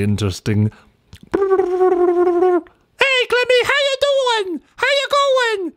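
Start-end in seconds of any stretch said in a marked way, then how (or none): 1.3-5.7 very high, excited bird chatter; jovial kookaburra. Acted. Cookie answers his friend in a squawking bird voice.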